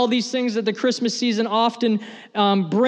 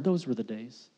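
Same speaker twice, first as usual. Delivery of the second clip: wistful murmur; 10 semitones lower, 11 dB quieter than usual. Acted. Wistful repeat of the phrase.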